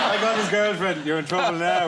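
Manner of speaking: loud, high-pitched dolphin banter; gossiping dolphin. Acted. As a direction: crow it loudly to a fellow dolphin. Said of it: "other dolphin would look at him and go".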